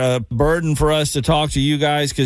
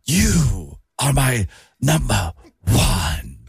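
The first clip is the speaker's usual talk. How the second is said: slow, low, gravelly-free hammed villain line; terrible Jack Palance impression; 6 semitones lower, much slower than usual. Radio host doing a bad Jack Palance for a contest. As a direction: drag it out slow like a movie villain. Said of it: "Who is that a terrible impression of?".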